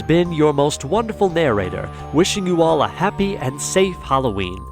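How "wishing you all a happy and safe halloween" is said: ordinary talk, nothing marked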